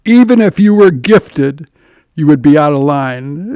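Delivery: deep, deliberate deadpan put-down; imitating a big friend's low-voiced rebuke; John. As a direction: repeat the famous line low and firm, pausing midway. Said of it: "Classic John line".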